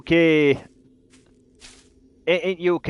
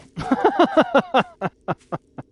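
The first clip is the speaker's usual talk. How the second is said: a burst of high-pitched laughter; short giggling laugh; 3 dB louder than usual. Real, not acted. Laughs after long absence.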